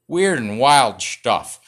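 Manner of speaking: slow, loud catchphrase; Johnny Carson impression. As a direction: drag out the catchphrase like a TV host. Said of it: "What's my Johnny Carson impression?".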